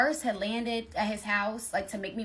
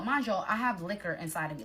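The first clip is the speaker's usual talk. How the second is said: usual speaking voice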